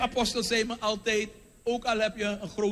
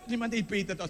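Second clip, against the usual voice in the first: ordinary talk, nothing marked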